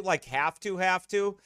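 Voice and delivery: "have to, have to" repeated with heavy emphasis; stress on HAVE TO. Real, not acted. Speaker admitting a new computer isn't essential, stressed "have to".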